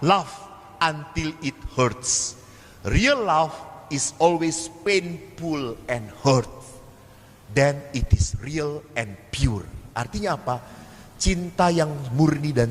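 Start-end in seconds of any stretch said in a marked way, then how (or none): 0.0-9.7 slow, weighty English quote of Mother Teresa; solemn quotation. Retold or imitated. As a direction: lay each word down slowly, like scripture. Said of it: "Ibu Teresa pernah berkata apa?". (kutipan Ibu Teresa dibacakan pelan dan khidmat)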